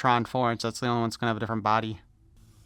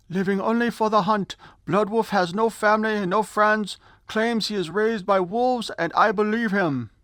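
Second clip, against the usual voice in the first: a bad Swedish accent, pitched up; 8 semitones higher than usual. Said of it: "I was trying to do a Swedish accent".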